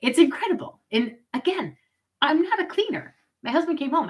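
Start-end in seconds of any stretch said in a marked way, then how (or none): none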